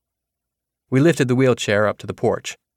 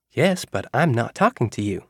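teasing, a smile you can hear; 3 semitones higher than usual. Acted. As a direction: gently rib the old man, smiling. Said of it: "Melissa said it with a smile".

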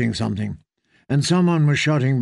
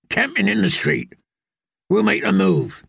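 higher, brisk soldier's report; a hint of a gruff guardsman; knight reporting; 7 semitones higher than usual. Sir Meryn reporting to the Queen about the sparrows.

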